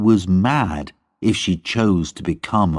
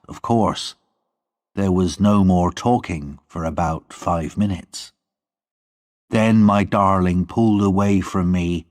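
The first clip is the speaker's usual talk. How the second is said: usual speaking voice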